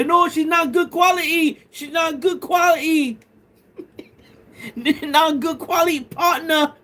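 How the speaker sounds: very high, hammed-up British accent; mock British accent. Streamer acting out the UK viewer rejecting a girl, mock British accent. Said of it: "that accent".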